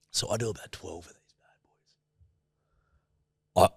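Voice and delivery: whispered, breathy confession; conspiratorial whisper. Retold or imitated. Whispered to the podcast host about the shots. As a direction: let the secret slip in a hushed voice.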